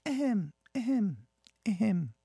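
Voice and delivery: high-pitched, prim little ahems; mock throat-clearing. Mock preparation before reading story.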